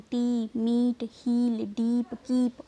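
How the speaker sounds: टी, मीट, हील, डीप, कीप slowly, each with long "ee"; long ee demo. Shown as a demonstration. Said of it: "ई की sound". (मीट, हील, डीप में ई खींचकर धीरे बोलें)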